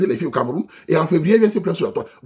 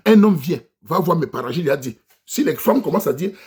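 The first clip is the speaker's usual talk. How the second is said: normal talking voice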